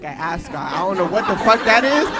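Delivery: ordinary talk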